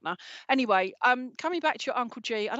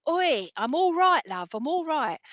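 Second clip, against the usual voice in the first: cheeky Essex geezer voice; light Essex accent, slowed down; 5 semitones higher than usual. Retold or imitated. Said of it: "that's me doing my Essex speak".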